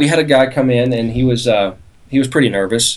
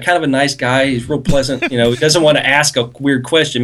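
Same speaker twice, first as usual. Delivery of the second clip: usual speaking voice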